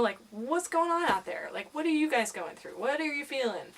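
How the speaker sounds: ordinary delivery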